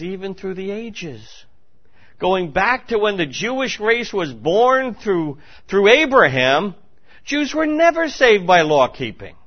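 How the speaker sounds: slow start swelling high and loud; rising pulpit fervour; building preaching. Acted. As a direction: build it to a cry.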